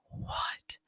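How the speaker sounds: whispered mock disbelief; hushed, astonished reaction; stunned whisper. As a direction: whisper it as if you can't believe your ears. Mother's stunned reaction, retold.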